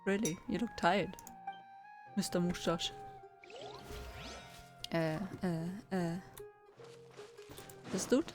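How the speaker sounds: normal talking voice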